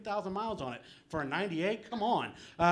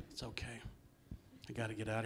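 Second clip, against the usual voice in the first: soft whisper; whispered apology to the crowd; whispered reassurance; 7 semitones lower, 14 dB quieter, faster than usual. Real, not acted. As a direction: breathe it out to calm the room. Reassuring aside after edgy joke.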